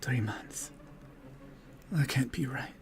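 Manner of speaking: a lightly voiced stricken dryad, hushed at first; shaken dryad. Dryad realizing her past deeds to the party, hushed and shaken.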